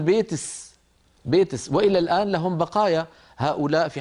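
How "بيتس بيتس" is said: "بيتس" ending in s instead of ki; kaskasa. Shown as a demonstration. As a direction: close "بيتس" on a hissed s. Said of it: "بالسين يقول".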